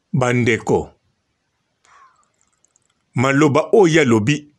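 normal talking voice

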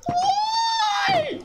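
very high, loud exasperated cry; shrieked why. Real, not acted. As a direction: scream it up at the sky in disbelief. Exasperated at the cow.

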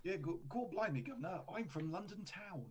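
hammed-up London Cockney, slowed down; a bit of put-on Cockney; mock Cockney. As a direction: play a cheeky stage Londoner. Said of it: "you sound like you got a little accent there".

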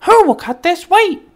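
very high, loud hen voice; hen asking. A narrator's reading. The hen asking the farm animals for help, high and loud. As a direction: call out brightly for helpers. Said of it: "the little red hen asked".

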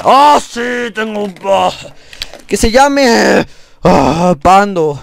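high and loud, drawn out with delight; a player naming his new panda in giddy triumph; thrilled. Real, not acted. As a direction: gush over the new pet. Gets a pet panda.

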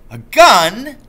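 father scoffing at his son's question; disgusted cry. Acted. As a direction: fling the words back in disgust. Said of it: "he cried, disgusted".

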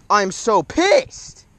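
high, slow, dragged-out angry cry; stretched-out angry wail; real frustration. Real, not acted. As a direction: let the frustration pour out. Just lost the big fish.

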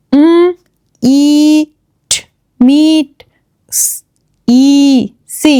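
ইট stretched as 'ee-t', then মিট blended; long E sound. Shown as a demonstration. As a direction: sound out স, ই slowly, then join as সি. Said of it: "ই এর long sound হবে". (লম্বা 'ঈ', ধ্বনি ভেঙে ভেঙে)

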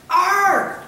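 loud, high parrot squawk; one big mock squawk; parrot's voice. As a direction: imitate a parrot yelling like a pirate. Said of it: "In a parrot's voice".

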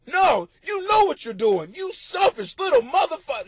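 high, loud mock rant at the baby; imitating furious parent. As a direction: vent at the screaming baby, half joking. Parent's thoughts at crying baby.

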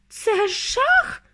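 high-pitched wail; distraught high cry; wailing mother. A narrator's reading. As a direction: wail in horror. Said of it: "голосила пані Тіві".

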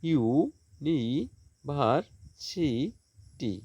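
"University" very slowly as ইউ, নি, ভার, সি, টি; five stretched beats with gaps in "university"; syllable by syllable. Shown as a demonstration. Teacher counting syllables for students, "university" slowly in five beats. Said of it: "পাঁচটা syllable".

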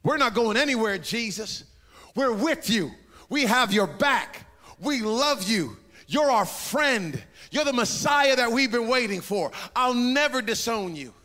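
loud, high, fervent promise; eager loyal pledge. Retold or imitated. Disciples swearing loyalty to Jesus, loud and fervent. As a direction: shout your loyalty like a devoted disciple.

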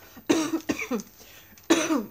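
sudden hard coughing fit; choking cough. Real, not acted. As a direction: cough after swallowing wrong. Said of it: "Sorry, ich habe mich verschluckt". (heftiges Verschlucken mit Husten)

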